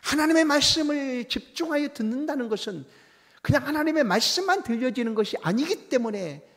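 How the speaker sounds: high, loud preaching cry; fervent preaching. Acted. Pastor exhorting the congregation, fervent and loud. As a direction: call out the truth with full force.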